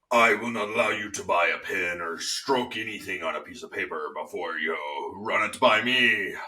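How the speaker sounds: slow, menacing villain voice; evil villain voice. Acted. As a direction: play the controlling evil boss. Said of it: "evil villain voice".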